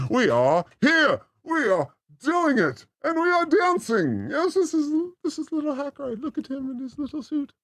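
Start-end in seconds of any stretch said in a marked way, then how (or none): none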